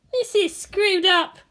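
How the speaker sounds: muttering. A narrator's reading. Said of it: "muttered Asuka".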